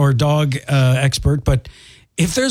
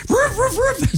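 yappy high dog barks; high barking imitation. Host imitating a dog barking back at a stranger, high yaps. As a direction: bark like a small excited dog, high. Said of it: "they hear bark and they're like".

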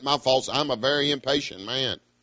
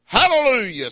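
ringing hallelujah; 8 semitones higher, 4 dB louder than usual. Acted. Quoting heaven's crowd.

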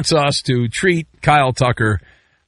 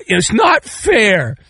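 drawn-out mock whine; hammed-up childish complaint; whining fanboys; 5 semitones higher, slower than usual. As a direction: imitate a pouting fan, slow and higher. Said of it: "and they're like, uh".

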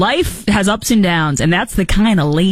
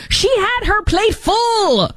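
mock high voice; slower than usual. Mocking aside about Jill.

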